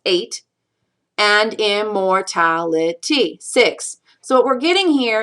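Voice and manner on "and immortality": "And immortality" broken slowly into syllables; counting out syllables. Shown as a demonstration. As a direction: measure out each syllable of "And immortality". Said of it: "count out the syllables".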